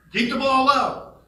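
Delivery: put-on old friend booming his baseball saying; booming advice. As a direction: boom it like a coach's catchphrase. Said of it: "an even bigger voice".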